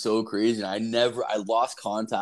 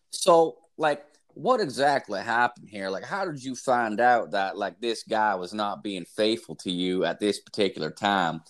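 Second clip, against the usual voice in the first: mock talk-show host questioning; hint of a TV therapist voice; Doctor Phil impression. Imitating Doctor Phil asking.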